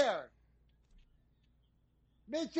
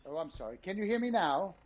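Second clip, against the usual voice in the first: much softer and lower after being told off; quieter; 5 semitones lower, 9 dB quieter than usual. Acted. Told he's over modulating, now much softer and lower. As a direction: pull right back from the mic. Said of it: "you're over modulating".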